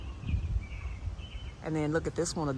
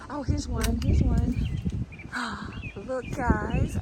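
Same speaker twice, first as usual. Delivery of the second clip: excited discovery; 7 semitones higher, slower than usual. Real, not acted. Spotting new blooms, excited gasp.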